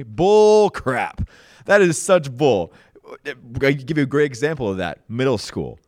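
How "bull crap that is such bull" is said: loud, scornful dismissal; emphatic disgust; loud scoff. Real, not acted. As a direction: spit out the word bull. Rejecting the saying.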